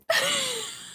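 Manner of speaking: a shrill, high-pitched squeal of laughter. Real, not acted. Laughing at her own ring-size joke, high squeal.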